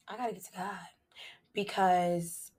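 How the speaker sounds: whispered, intimate confession; confessional whisper. Real, not acted. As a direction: lean in and whisper it.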